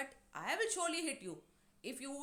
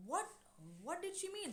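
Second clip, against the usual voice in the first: higher, puzzled question; mildly shocked; 7 semitones higher than usual. Retold or imitated. George to his wife about Doris, mildly shocked. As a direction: ask it in mild retold shock. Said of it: "all of them are so shocked".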